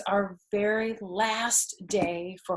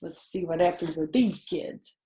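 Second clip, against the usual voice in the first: ordinary delivery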